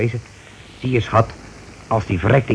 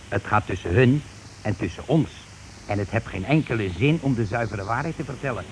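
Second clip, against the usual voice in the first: dropping much softer, down to a murmur; growing quieter line by line, confiding a scheme; conspiratorial hush; 10 dB quieter than usual. Acted. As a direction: lean in and sink to a secret hush. Husband confides scheme to wife.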